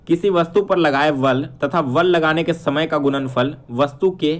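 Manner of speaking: ordinary talk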